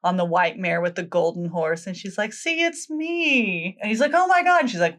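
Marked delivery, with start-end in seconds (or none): 2.3-3.8 high, drawn-out sing-out; playful reveal. Retold or imitated. Girl reveals herself to prince. 4.1-4.6 high, louder burst of astonishment; mock amazement. Prince amazed by the reveal.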